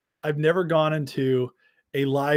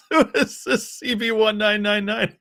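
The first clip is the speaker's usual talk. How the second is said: laughing; 8 semitones higher than usual. Real, not acted. Reading troll name, laughing.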